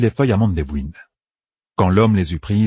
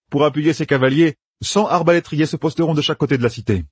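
lightly raised, eager commander; planning aloud with brisk confidence; a hint of a young general laying out plans; Matt's voice; 7 semitones higher than usual. A narrator's reading. Narrator voices Matt.

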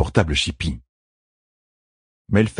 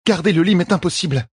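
higher, lightly voiced queen, indignant; protesting queen; 12 semitones higher than usual. A narrator's reading. Queen protests to midwife, lightly indignant. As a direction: push back against the order.

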